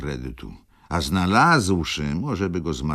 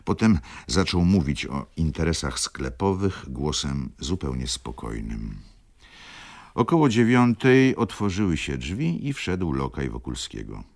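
usual speaking voice

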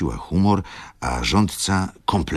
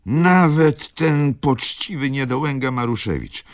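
high, loud accusation; dramatic indignation; 12 semitones higher, 5 dB louder than usual. A narrator's reading. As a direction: declaim it in scandalised outrage. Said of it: "Dramatyczny głos baronowej". (dramatycznie, z głośnym oburzeniem)

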